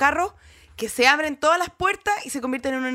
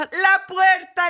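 high, loud, drawn-out lament; melodramatic cry; 7 semitones higher, 5 dB louder, much slower than usual. Retold or imitated. Actor listing what fell on her fingers, melodramatic cry. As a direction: declaim it slowly like a stage tragedy. Dramatic climax of the accident story.

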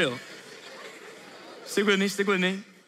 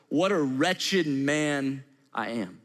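slow and lower, lingering on "What a wretched man I am"; slow lament; 8 semitones lower, much slower than usual. Acted. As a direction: draw out each word, heavy with regret. Quoting Paul's lament, repeated.

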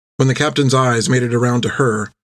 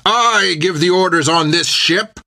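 shouting; 7 semitones higher than usual. A narrator's reading. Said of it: "the captain shouted".

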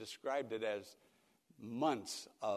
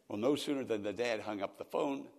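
ordinary talk

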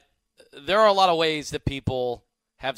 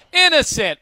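mock verdict shout; 9 semitones higher, 6 dB louder than usual. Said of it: "Ken goes".

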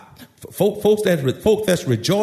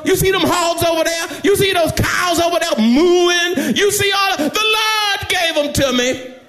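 stammering start climbing into high, ringing preaching; building excitement; 12 semitones higher than usual. Acted. Shouting John pointing out what the Lord gave, excitement mounting. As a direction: pile up each item, rising toward a shout.

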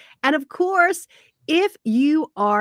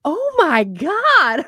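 drawn-out, high, loud gasp of disbelief; mock shock; 7 semitones higher, 5 dB louder, much slower than usual. Friend reacting to the Beastie Boys, in mock shock. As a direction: imitate a stunned friend, stretching it out. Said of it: "looking at me in shock".

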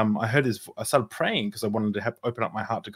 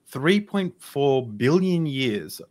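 slow, drawn-out amazement; stretched-out wonder, a little higher; awed; 4 semitones higher, 3 dB louder, much slower than usual. Retold or imitated. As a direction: marvel at it slowly. Awed quote to the beagle.

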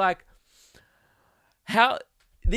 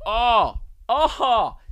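loud, higher cry of exasperation; exasperated outburst; 6 semitones higher, 4 dB louder than usual. Real, not acted. Podcaster venting about what annoyed him, exasperated. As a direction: let out a loud, aggravated groan of a word. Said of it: "that pissed me off".

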